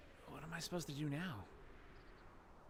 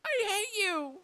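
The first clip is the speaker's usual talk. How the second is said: high, loud shout of hate; hateful scream; 10 dB louder, much slower than usual. Acted. Dan screams his hatred at Faye, furious shout. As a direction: throw it at her with all your grief.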